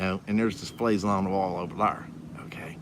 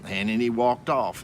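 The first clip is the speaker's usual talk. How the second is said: ordinary talk, nothing marked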